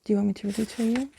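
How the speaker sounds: ordinary delivery, nothing marked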